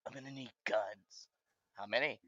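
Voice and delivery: low, soft gravelly-free hush of the hero; hushed John Wick quote. Retold or imitated. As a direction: quietly impersonate John Wick's line. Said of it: "he's always like".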